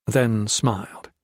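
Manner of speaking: ordinary delivery, nothing marked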